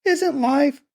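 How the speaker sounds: put-on high falsetto girl, trailing unfinished; faltering girl. A narrator's reading. Laura trying to explain to her brother, faltering falsetto. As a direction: grope for words in a girlish squeak. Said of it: "she stammered".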